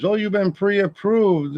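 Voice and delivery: high, drawn-out sales pitch; imitated loan caller. Loan caller pitching an offer, imitated high and slow.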